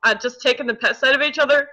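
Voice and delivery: ordinary talk, nothing marked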